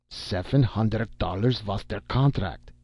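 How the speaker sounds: put-on German-accented cry of protest; put-on German accent, insistent; indignant German accent. A narrator's reading. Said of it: "cried the voice of Herr Schreckenheim".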